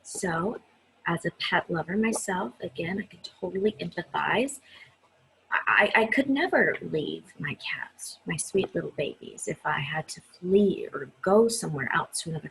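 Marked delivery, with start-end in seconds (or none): none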